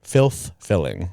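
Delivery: "Filth filling" spelled out slowly with a clear th. Shown as a demonstration. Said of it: "it sounded like you said".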